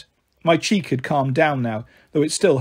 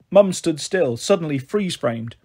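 plain reading voice

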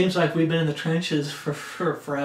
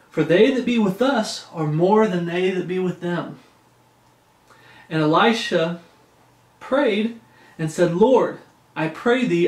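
raised, measured Bible reading; scripture reading; 3 semitones higher, 4 dB louder than usual. Acted. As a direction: read it out like preaching from the pulpit. Reads Second Kings aloud.

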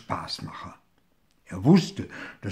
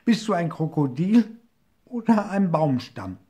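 higher, louder questioning call; 6 semitones higher, 5 dB louder than usual. A narrator's reading. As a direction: shout the question across the river. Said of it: "rief der kleine Hirsch". (hoher, lauter fragender Ruf)

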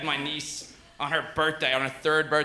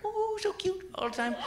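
put-on very high, soft baby-talk squeal; tiny pitched-up mock cooing; gushing coo; 13 semitones higher, 6 dB quieter than usual. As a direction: coo over an adorable toddler. Gushing over his little niece.

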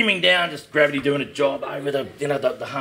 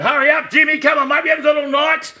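much higher and louder, barking to hurry; impatient shout; 9 dB louder than usual. Retold or imitated. Sergeant in the car hurrying the young cop, impatient shout. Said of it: "the Sarge is driving the car up saying".

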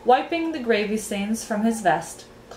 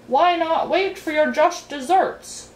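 slow, higher, mouth-full earl; indistinct mumble; 8 semitones higher, 4 dB louder than usual. A narrator's reading. Earl at the banquet table, mouth full, mumbling indistinctly. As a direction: mumble it as if chewing. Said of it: "mumbled the earl indistinctly, his mouth full of food".

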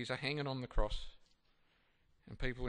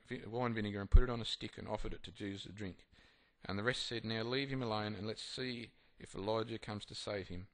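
louder, measured Bible reading; formal reading voice; scripture reading; 5 dB louder than usual. Acted. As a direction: read the passage out to the group. Reads scripture aloud.

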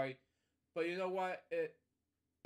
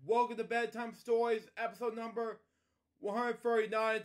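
higher, louder cheerful welcome; bright show intro; 7 semitones higher, 6 dB louder than usual. Acted. Host opening a bedtime story video, cheerful and louder. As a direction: greet the viewers like a kids' show host.

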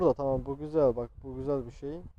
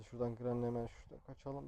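normal talking voice

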